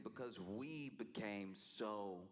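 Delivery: ordinary talk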